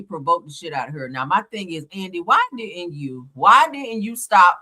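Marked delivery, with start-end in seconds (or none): 1.9-4.6 exasperated. Real, not acted. Scolding a reality star.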